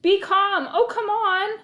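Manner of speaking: loud, high shout; exasperated chase cry; desperate shout. Acted. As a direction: yell while chasing. Chasing runaway dogs.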